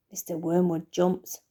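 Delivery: ordinary delivery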